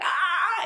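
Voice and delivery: high, loud attention-getting shriek; imitated baby scream. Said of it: "he screams at them".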